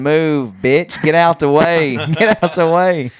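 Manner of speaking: loud mock yelling at people; rowdy drunk holler; retold yelling. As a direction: bark it out like a rowdy kid banging car hoods. Drunk friend yelling leaving concert.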